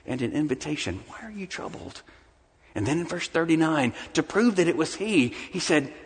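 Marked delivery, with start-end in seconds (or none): 1.1-2.0 very soft, tender question; almost breathe it, kindly. Acted. Jesus tenderly asking his disciples, very soft. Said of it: "He came to them in kindness".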